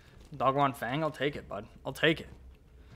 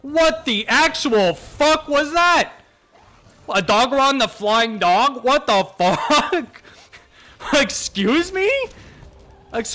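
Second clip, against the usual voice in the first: slow, much higher, loud disbelieving yell; shocked disbelief; 11 semitones higher, 5 dB louder, slower than usual. Real, not acted. Gamer yelling in disbelief at a monster running on thin air. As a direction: stretch each word out in outraged shock.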